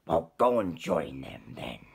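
low, loud lobster voice; grumpy lobster. Acted. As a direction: brush him off like a cranky lobster. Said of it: "said the lobster".

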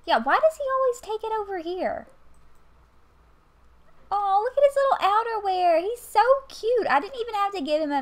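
high gushing cuteness voice. Real, not acted. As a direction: coo over something adorable. Gushing over her Sim.

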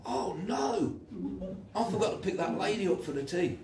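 high, startled "oh no" with a laugh; half-laughing panic; dismayed realisation. Retold or imitated. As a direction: catch yourself in horror at the forgotten promise. Sudden memory of broken promise.

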